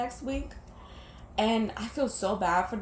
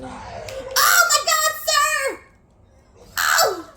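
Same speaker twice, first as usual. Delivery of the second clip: high, loud, drawn-out shriek at a jumpscare; sudden terrified yell; startled scream; 7 dB louder, much slower than usual. Real, not acted. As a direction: shriek in real fright. Jumpscare in the game.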